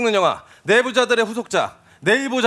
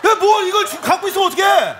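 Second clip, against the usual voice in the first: angry yelling; 7 semitones higher, slower than usual. Real, not acted. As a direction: yell at the useless extras. Director loses his temper.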